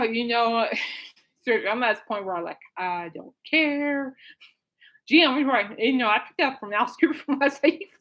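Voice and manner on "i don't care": long drawn-out, slow mock apathy; couldn't-care-less drawl; sarcastic indifference. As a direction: stretch the last word way out, sarcastically. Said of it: "hear the sarcasm leaving Alice, Alice's voice".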